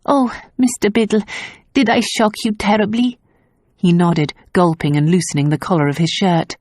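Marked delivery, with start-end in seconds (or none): 0.1-3.2 higher, concerned question; puzzled. A narrator's reading. Tazia asking stunned Biddle, higher concern. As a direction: ask gently, puzzled.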